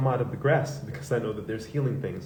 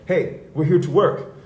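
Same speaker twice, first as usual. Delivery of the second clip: louder, slower reprimand; stern partner; 5 semitones higher, 8 dB louder, slower than usual. Retold or imitated. Business partner scolding him on the shoot, a stern louder rebuke. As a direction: scold him to get back to work. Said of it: "And she's like".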